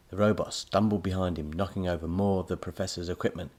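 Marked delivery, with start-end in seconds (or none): none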